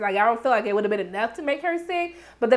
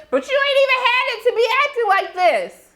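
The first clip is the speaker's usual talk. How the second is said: yelling at the screen; 11 semitones higher than usual. Real, not acted. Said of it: "she done made me yell".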